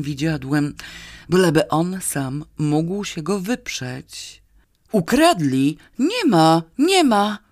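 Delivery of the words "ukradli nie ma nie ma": loud, high, emphatic insistence; with great force. A narrator's reading. Drunk convincing a policeman of the theft, forcefully. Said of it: "dodał z taką mocą".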